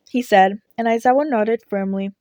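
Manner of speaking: ordinary delivery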